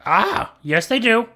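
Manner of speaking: high-pitched crow, answering; squawk, then answer up high like a crow. Acted. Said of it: "not good at the crow noises".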